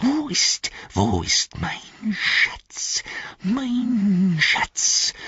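slow, strained hiss leaping high; sharper hissing creature. A narrator's reading. Gollum cursing the thieves of his treasure, sharp hissing. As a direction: wail and hiss, stretching the words. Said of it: "das Zischeln wurde schärfer und deutlicher".

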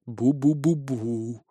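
slow, low, soft "boo-boo" drone; eerie distant mumbling. A narrator's reading. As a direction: drone eerily out of the darkness. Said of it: "раздалось из темноты". (жуткое тихое бубнение)

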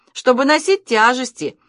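a loud high explanation; shouting. A narrator's reading. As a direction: keep shouting down the street. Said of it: "кричали они на всю улицу".

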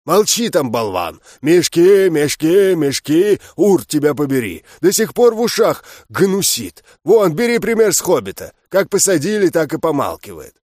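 loud barking orc; guttural rough orc roar. A narrator's reading. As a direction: bark it out like an angry orc. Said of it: "орк рявкнул".

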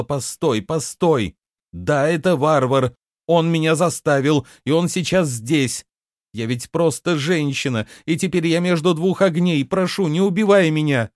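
high, desperate pleading; crying out. A narrator's reading. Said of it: "она выкрикнула". (умоляюще выкрикивая, высоким голосом)

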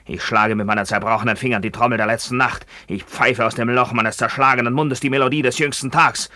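rising to a high, loud command; building tirade. Acted. Maimed soldier hammers his doom at her, rising to a shout. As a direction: swell into a fierce proclamation.

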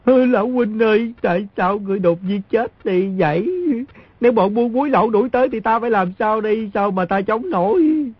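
high, hammed-up sobbing lament, slowing at the end; fake wailing. Boy keeps up his fake mourning as the smugglers approach, high pretend wailing. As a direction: wail and beg high as if lost without him. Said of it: "kêu gào".

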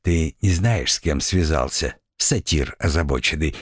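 low, grimly amused threat; grim smile. Acted. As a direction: say it with a dark smile. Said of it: "с мрачной улыбкой ответил".

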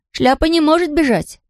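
put-on high-pitched girl insisting; girl's voice. A narrator's reading. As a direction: correct him in a high girlish voice. Marlotta contradicts, high girl voice.

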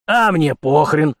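slow, loud dismissive retort; harsh barking commands. A narrator's reading. (грубо и пренебрежительно)

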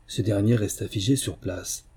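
plain reading voice

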